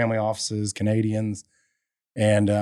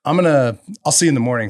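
higher, louder, laughing through it; laughing defiance; 6 semitones higher, 6 dB louder, slower than usual. Retold or imitated. Gideon answering University Lands' refusal, laughing defiance. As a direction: shrug off the no, grinning.

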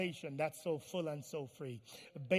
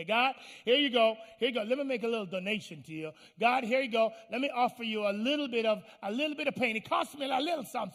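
mock offering; 5 semitones higher, 7 dB louder, faster than usual. Mimics token givers offering to God.

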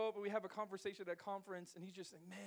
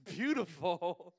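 very slow, louder, teasing beautiful; mock drawn-out beautiful; 6 dB louder, much slower than usual. Pastor teasing the young speaker, slow mocking beautiful. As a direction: stretch "Beautiful." out slowly, mocking. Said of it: "He goes".

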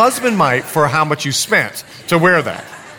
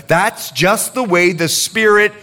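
ordinary talk

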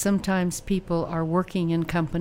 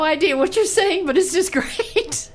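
laughing; 11 semitones higher, 3 dB louder than usual. Real, not acted. Amazed by the guest's languages.

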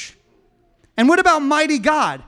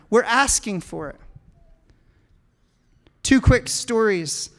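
ordinary delivery

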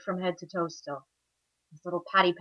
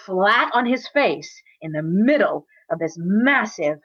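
plain reading voice

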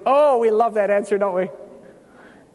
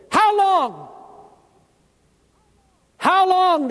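martyrs' cry; 9 semitones higher, 4 dB louder, much slower than usual. Acted. Martyrs crying out to God.

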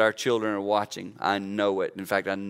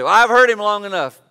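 higher, louder exasperated mimic of a wife; mock fed-up wife; 10 semitones higher, 11 dB louder than usual. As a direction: toss it off like a wife who's had enough.

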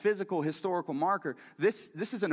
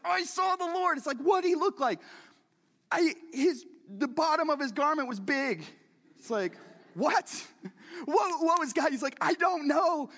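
excited back-and-forth; 9 semitones higher than usual. Retold or imitated. Playful dialogue, excited questions.

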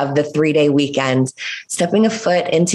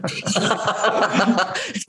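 a quick amused laugh at herself; short real laugh; 4 semitones higher, 4 dB quieter than usual. Real, not acted. Guest laughing at her own car joke, light laugh.

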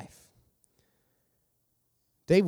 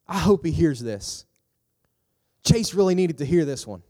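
judgmental listener; 4 semitones higher, 4 dB louder than usual. Acted. Voices a judgmental listener's thoughts.

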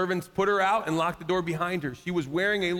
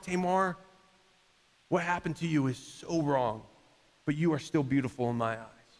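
softer, lower, gentle reassurance; tender comfort; 4 semitones lower, 4 dB quieter than usual. Pretend. Jesus comforting Tamar, soft and tender. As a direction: kneel and comfort her gently.

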